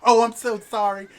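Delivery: very high, loud, slow squeal of delight; gushing noblewoman. Acted. A noble wife squealing over a girl's curtsy, very high.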